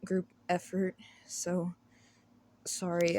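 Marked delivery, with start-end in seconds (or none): none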